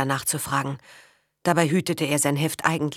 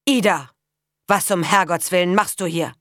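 louder, sharp call of an angry father; 3 semitones higher, 4 dB louder than usual. Acted. Father catching his daughter with Karl, angry call. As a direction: catch her out with a stern bark.

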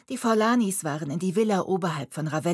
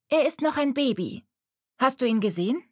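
put-on young girl; 7 semitones higher than usual. A narrator's reading. Girl explains to Mariella her kitten is a baby. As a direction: speak as the worried prim girl.

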